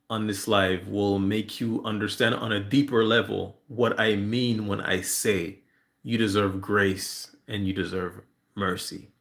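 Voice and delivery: normal talking voice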